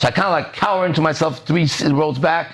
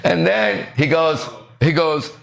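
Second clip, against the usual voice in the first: usual speaking voice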